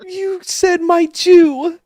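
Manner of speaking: very high, slow, wailing falsetto; mocking echo. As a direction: stretch it out in a shrill mock wail. Mocking a friend's remark.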